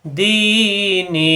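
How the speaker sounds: দিনি with the long ি drawn out slowly, recitation style; stretched vowel. Shown as a demonstration. Teacher stretching দিনি slowly for the students. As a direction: hold the vowel in দিনি for a full beat. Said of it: "এই দিকে লম্বা করতে হবে".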